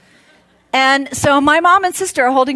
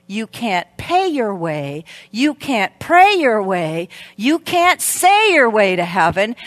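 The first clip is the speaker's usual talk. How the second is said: normal talking voice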